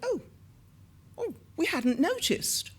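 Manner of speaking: a flustered, sheepish little admission; caught-out oh. Retold or imitated. Producer Cynthia caught out by the question, high startled oh.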